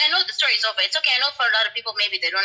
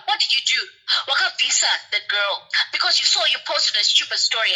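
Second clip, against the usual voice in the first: normal talking voice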